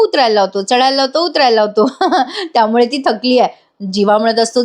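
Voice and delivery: ordinary talk, nothing marked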